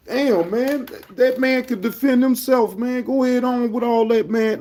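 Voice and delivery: high-pitched, fed-up complaint; exasperated dismissal. Real, not acted. Streamer tells chatter to quit defending another man, exasperated. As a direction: wave them off in a high, exasperated voice.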